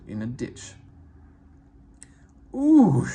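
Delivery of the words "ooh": a sudden high, loud cry of dismay; big engine yelping in the mud; loud dismayed ooh. A narrator's reading. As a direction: wail as the wheels sink. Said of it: "he hissed".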